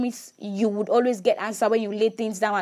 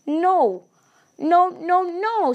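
higher and louder repeated no; emphatic no; 9 semitones higher, 4 dB louder, much slower than usual. Real, not acted. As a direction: shut the idea down firmly. Emphatic refusal.

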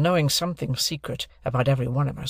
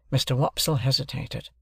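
plain reading voice

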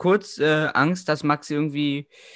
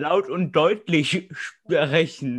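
mock slow; much slower than usual. Mocking friend's cork speech.

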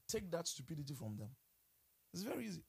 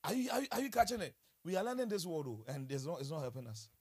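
ordinary delivery

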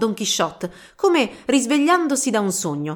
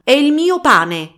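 slow, loud, high yell; shouted protest; 6 semitones higher, 7 dB louder, slower than usual. Acted. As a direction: drag out the shout in outrage. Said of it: "gridò un'altra".

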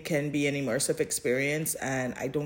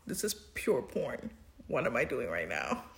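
quietly retold embarrassment, much softer; embarrassed hush; 5 semitones lower, 10 dB quieter than usual. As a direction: mutter it as if caught out. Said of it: "I was like".

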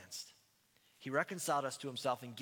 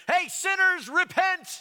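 mock soapbox shout, much higher and louder; street preacher yelling; 14 dB louder, much slower than usual. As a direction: bellow it like a street-corner preacher. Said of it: "just start yelling".

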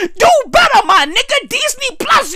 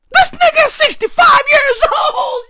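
higher, louder half-crying shout; wailing disbelief; 6 semitones higher, 3 dB louder than usual. Real, not acted. Ranting that the man is sixty-five, wailing shout. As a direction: wail it in disbelief.

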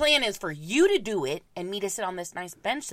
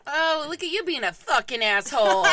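mock insult; 6 semitones higher, 7 dB louder than usual. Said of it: "I just tell my kids".

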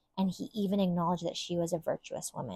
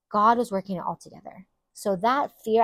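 ordinary delivery

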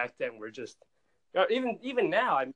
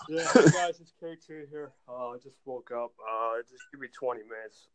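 just woke up; 4 semitones lower than usual. Acted. Host reenacts his own just-woke-up greeting, groggy and low. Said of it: "reenact that right now".

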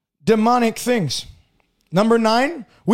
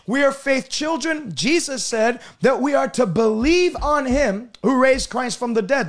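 ordinary talk

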